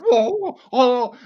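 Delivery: high, loud character exclamation; sudden high whoa in character. Retold or imitated. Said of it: "in one character".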